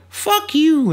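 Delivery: high mock-queen outburst. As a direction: shoot up high as an indignant queen. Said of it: "the queen's like".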